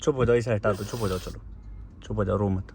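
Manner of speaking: low, hushed comforting; gently calm someone who is crying, voice dropped low; soft, deep reassurance; soothing. Real, not acted. Son soothes his crying mother.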